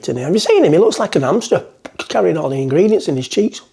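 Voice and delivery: ordinary talk, nothing marked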